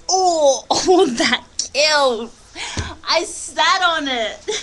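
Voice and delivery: loud, drawn-out groan of pain, half laughing; pained yelp. Real, not acted. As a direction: cry out slowly at a sudden hurt. Hurt herself sitting on the didgeridoo.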